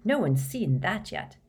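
ordinary delivery, nothing marked

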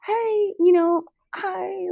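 bright, high polite phone voice. Retold or imitated. Retelling asking a stranger a favour, in a high phone voice. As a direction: sweeten up like a customer call. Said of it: "using my best, like, your phone voice".

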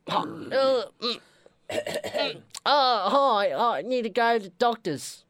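put-on sick patient in a British accent. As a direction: croak it out in a bad British accent. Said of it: "something with British accents".

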